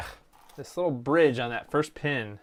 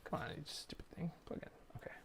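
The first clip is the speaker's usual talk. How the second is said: hissed under the breath; frustrated whisper; 4 semitones higher, 21 dB quieter, faster than usual. Real, not acted. Fiddling with a stubborn plug, whispering.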